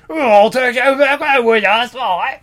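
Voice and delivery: put-on slow, gruff Irish accent. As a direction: growl it in thick Irish. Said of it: "in thick Irish accents".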